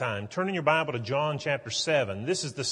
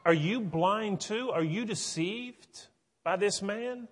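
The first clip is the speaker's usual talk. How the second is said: higher and slow, scornful disbelief; incredulous Pharisees; 7 semitones higher than usual. Retold or imitated. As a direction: drag out the scorn at the officers. Pharisees berate the officers.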